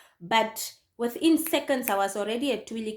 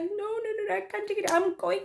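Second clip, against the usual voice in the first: high, whiny protest; mock tearful insistence; acted distraught refusal; 10 semitones higher, 3 dB quieter, faster than usual. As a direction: re-enact being too upset to stay. Distraught refusal to friend, retold.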